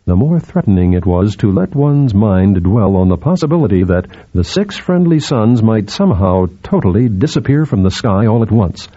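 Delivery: usual speaking voice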